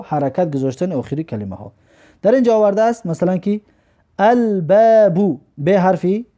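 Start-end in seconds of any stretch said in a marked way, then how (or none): 4.2-5.4 "الباب" very slowly as al-baabu, L clearly sounded; L pronounced. Shown as a demonstration. Teacher demonstrating a moon letter, L pronounced slowly in "الباب". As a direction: stretch out "الباب", keeping the L before the B. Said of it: "برام خاطر لام تلفظ کردیم".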